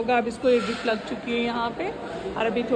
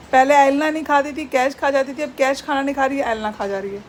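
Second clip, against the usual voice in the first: ordinary talk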